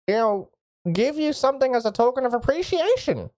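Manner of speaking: a high, effusive, eager local mage; eager mage Artemis. Acted. Mage Artemis greets the strangers and offers a reward, eager and high. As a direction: gush a welcome over the strangers.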